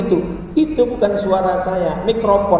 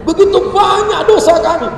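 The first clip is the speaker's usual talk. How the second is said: high-pitched put-on lament; mock tearful plea; 11 semitones higher than usual. Novice leader trying to make worshippers cry, mock tearful plea. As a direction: overdo the weeping confession. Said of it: "untuk buat orang nangis saya nggak bisa".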